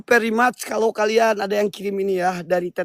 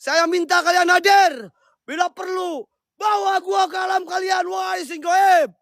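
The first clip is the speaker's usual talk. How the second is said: summoning shout; 8 semitones higher than usual. Acted. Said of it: "Teriak-teriak itu capek".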